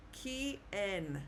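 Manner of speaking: "Quien" split as kee, EN; slowly separated "Quien", kee then en; broken into syllables. Shown as a demonstration. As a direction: say "Quien" in two separate parts, U silent. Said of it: "is how we pronounce the word".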